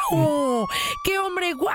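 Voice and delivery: smitten admiration. Retold or imitated. Policewoman seeing the hunk drive by, smitten and wowed. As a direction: gush as the awestruck admirer. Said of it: "dijo igual que yo".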